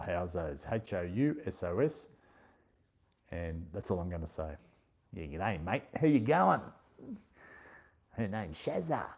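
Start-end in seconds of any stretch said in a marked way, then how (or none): 5.1-9.1 broad bogan Australian accent, pitched up; heavy Australian accent. Shown as a demonstration. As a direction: ham up a thick Aussie bogan greeting. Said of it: "I can do it, but I won't".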